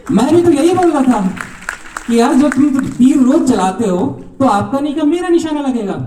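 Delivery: spring the point on them with glee; triumphant punchline. Retold or imitated. Heckler turns the tables on the archers, gleeful.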